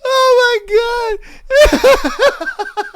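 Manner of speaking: high shouted laughing oh my God; howling laugh. Real, not acted. Streamer laughing at viewers, high and loud. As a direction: scream it out mid-laugh.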